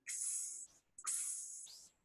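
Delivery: "ks" as a bare consonant cluster, no vowel; KS sound demo. Shown as a demonstration. Teacher showing pupils the sound of X as CS, bare "ks". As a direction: make a soft, hissed "ks". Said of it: "ele tem o som do C S, que é aquele".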